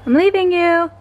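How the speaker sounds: high teasing voice. Real, not acted.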